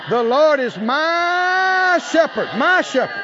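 "my" hit loud and drawn out, the whole line slowed; stress on my. Shown as a demonstration. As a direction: lean hard on "my" in "The Lord is my shepherd". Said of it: "you need to say that "my" with some emphasis".

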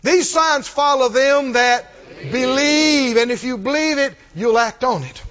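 loud, drawn-out scripture proclamation; forceful preaching. Real, not acted. Preacher declaring signs to believers, forceful preaching. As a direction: proclaim it to the congregation, stretching key words.

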